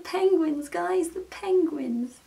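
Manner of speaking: high, slow, thrilled build-up; can't contain the excitement about the penguins; excited squeal. Real, not acted.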